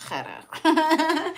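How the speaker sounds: Hera said high and loud through a laugh; mocking laugh. Mother mocks Omi's Hera, laughing loud and high. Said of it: "And my mom was like".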